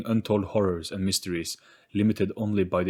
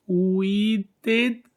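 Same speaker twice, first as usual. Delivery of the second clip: ordinary delivery, nothing marked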